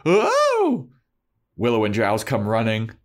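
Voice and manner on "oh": very high, loud startled shriek; hammed-up high yelp; mock scream. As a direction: scream in exaggerated fright. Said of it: "He screams".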